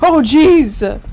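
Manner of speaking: sudden loud yelp; startled shout. Real, not acted. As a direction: blurt it out loud in fright.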